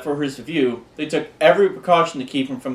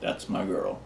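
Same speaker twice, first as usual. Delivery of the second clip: low, soft devil imitation; hushed, low devil father; devil character; 8 semitones lower, 12 dB quieter than usual. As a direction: attempt a devil's voice, dropped low and quiet. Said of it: "And the devil goes".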